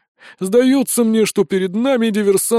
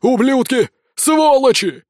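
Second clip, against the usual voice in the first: high, loud angry shouts; shouting after them; 8 semitones higher, 5 dB louder, slower than usual. A narrator's reading. Village elder to the fleeing intruders, shouting. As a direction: hurl insults at people getting away. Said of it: "кричал нам вслед староста".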